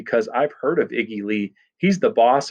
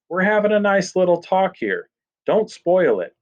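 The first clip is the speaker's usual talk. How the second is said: high-pitched sarcastic niceness; mock-pleasant scolding; 8 semitones higher than usual. A narrator's reading. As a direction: chide him with false friendliness, pitched up. Boss mockingly scolds the boy.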